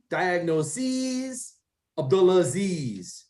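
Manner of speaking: "Diagnoses" and "Abdulaziz" drawn out to rhyme on "-eez"; matching long -eez endings. Shown as a demonstration. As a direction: stretch the last syllable of "Diagnoses" and "Abdulaziz". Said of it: "Just like your name".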